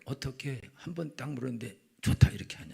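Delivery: 어떻게 한 번에 하냐고 속삭이듯; 흉내 내는 속삭임; whispered quote. Retold or imitated. Chungcheong person's mock complaint. (속삭이며 따지는 충청도 사람)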